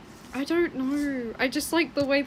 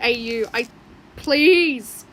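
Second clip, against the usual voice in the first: loud, exasperated please!; exasperated shout; 9 dB louder, slower than usual. Real, not acted. Streamer protesting freaky fan art, loud exasperated please. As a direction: throw up your hands with a loud please!.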